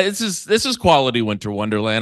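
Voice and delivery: ordinary delivery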